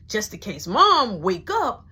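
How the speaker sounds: ordinary talk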